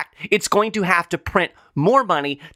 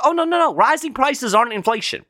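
much higher, sing-song mock denial; mocking the Fed; 9 semitones higher than usual. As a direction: brush it off in a high, patronizing mock voice. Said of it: "they're gonna tell us".

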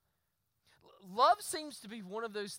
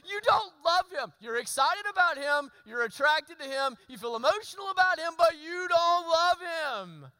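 mock shouting at the crying girl; exasperated outburst; 7 semitones higher, 8 dB louder than usual. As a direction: blurt it out half-laughing in disbelief. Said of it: "all I wanted to say is".

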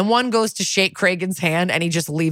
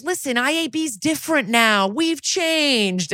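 higher, put-on smug voice; oily reassurance from an internal affairs cop; 9 semitones higher, slower than usual. Detective IAB acted as smug.

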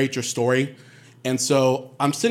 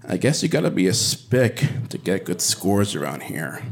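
lower, lightly voiced snide old lady; 7 semitones lower than usual. Blanche leaning over to him with a snide lower aside.

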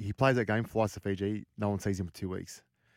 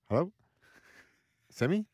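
high, bright mock of the mate answering; high carefree hello to a worried caller; cheerful Semi on phone; 10 semitones higher than usual. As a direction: play him breezy like nothing is wrong.

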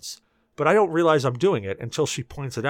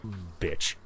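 grumbling; 7 semitones lower, 7 dB quieter than usual. Retold or imitated. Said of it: "I'm like".

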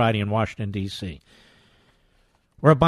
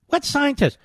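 much higher, disbelieving "What scientists?"; incredulous repeat; 12 semitones higher than usual. Real, not acted. Radio host repeats the question to the listeners, high-pitched disbelief.

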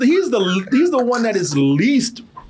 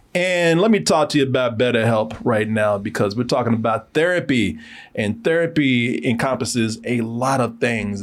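lower, calm sponsor read; lowered, calm podcast ad read for a therapy service; 11 semitones lower than usual. Acted. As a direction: slide into a relaxed ad spot.

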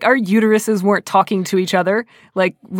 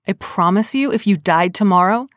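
ordinary talk, nothing marked

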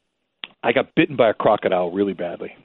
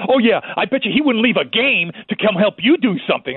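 high-pitched sarcastic retort; pissed-off wife; 9 semitones higher than usual. Retold or imitated. Wife scoffing at husband's famous friend, angry. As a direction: throw it back like a fuming wife. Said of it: "she got so pissed at me".

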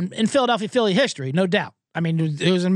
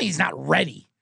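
normal talking voice

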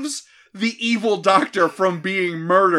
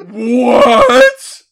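laughing disbelief; 5 semitones higher, 11 dB louder than usual. Real, not acted. Reacting to absurd plot twist.

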